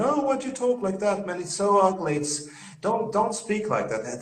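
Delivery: high-pitched imitation of a disapproving local; mimicked dismay, pitched well up; mock disgusted complaint. Retells pub local's disgusted objection.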